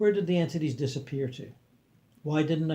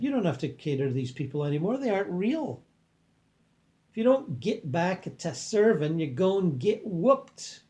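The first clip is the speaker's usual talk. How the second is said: put-on Southern accent; 4 semitones higher than usual. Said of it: "That's my impression of the accent".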